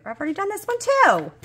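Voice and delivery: high, loud exasperated whine; annoyed cry at a repeat card. Real, not acted. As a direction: complain it's done, way up high. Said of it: "I'm gonna get aggravated".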